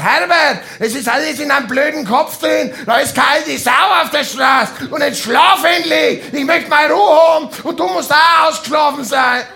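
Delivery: loud, high exasperated yelling; hammed-up furious shout; mock yell. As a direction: shout him down to sleep, fed up. Yelling at Herbert to sleep.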